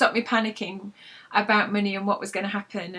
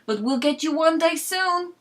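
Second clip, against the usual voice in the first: Frank's teasing vow; 8 semitones higher, 5 dB louder, slower than usual. Acted.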